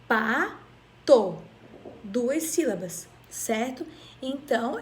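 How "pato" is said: "pato" broken as pa, long pause, to; split into syllables. Shown as a demonstration. Teacher showing two mouth openings, "pato" split in two. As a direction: punch both syllables of "pato" apart. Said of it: "eu abri minha boca duas vezes".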